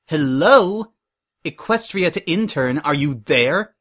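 loud, high mock hail to a daydreamer; irritated. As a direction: snap an intern out of a daydream, annoyed. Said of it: "looking more than a little irritated".

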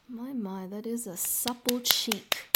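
usual speaking voice

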